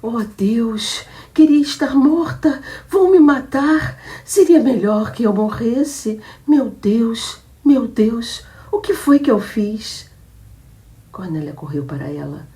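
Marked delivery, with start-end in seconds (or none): 0.0-10.1 much higher, louder, slower wailing lament; hysterical crying. Acted. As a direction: sob out the despair. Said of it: "começou a chorar histericamente". (choro histérico, agudo e alto)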